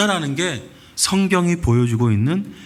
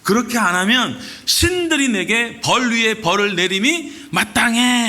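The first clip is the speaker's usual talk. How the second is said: threatening; 8 semitones higher than usual. Retold or imitated. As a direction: hurl the threat at him like an enraged queen. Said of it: "하면서 협박을 해요".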